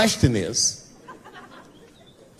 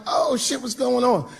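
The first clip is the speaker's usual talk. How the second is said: pitched-up surprised hello; surprised greeting; 9 semitones higher than usual. Retold or imitated. Greeting his old friend backstage, pitched up and surprised. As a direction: greet him with startled cheer. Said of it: "I'm like".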